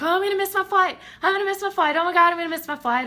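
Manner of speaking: high, panicky fretting; mock freaking out. Everybody around me about to miss flights, mock panic. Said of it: "they start this freaking out".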